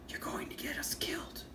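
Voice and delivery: high, soft whispered voice effect; altered character voice. Acted. Reviewer voicing comic dialogue in a high whispery effect voice. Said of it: "I'm gonna do the voice".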